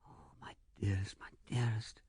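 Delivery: soft, tender breath of endearment; hushed and adoring; sinking whisper. A narrator's reading. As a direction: let the voice drop to a loving whisper. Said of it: "His voice sank to an inaudible whisper".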